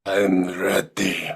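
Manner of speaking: slow low vampire drawl; a hint of a vampire lord. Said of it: "debating blackface in a vampire voice".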